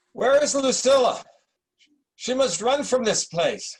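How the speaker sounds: delirious emperor shouting for Lucilla; alarmed cry. Acted. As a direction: cry out for your daughter in panic. Delirious emperor calls for daughter.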